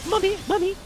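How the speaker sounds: put-on very high, pleading Dickensian child; falsetto British orphan. Begging mommy for cereal, in falsetto British orphan voice. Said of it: "I can only do that falsetto".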